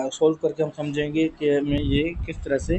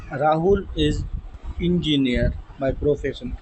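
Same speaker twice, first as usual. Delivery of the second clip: each word of uniform on Wednesday drawn out; slow dictation; much slower than usual. Shown as a demonstration. Teacher reading the example to students, slowly. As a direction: spell it out slowly for the class.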